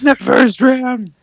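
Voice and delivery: squeaky high mock indignation; mock outrage. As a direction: squeal it in fake disbelief.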